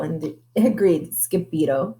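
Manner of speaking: plain reading voice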